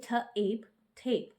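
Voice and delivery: ठ, एप, थेप sounded out slowly, sound by sound, then blended; teacher sounding out थेप; sounding out with magic e. Shown as a demonstration. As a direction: break थेप into ठ and एप, then join them. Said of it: "तो हम उसको कहेंगे".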